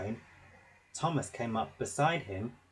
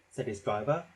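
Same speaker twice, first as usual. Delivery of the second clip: ordinary delivery, nothing marked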